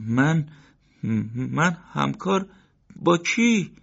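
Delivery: high, halting, frightened grocer; panicked disbelief, rising high; scared stammer. Acted. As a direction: stumble over the words in fear.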